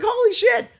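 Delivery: high, loud retold astonishment; imitating a stunned outburst; startled recognition. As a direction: exclaim it as if suddenly recognising someone. Acted reaction on recognising her.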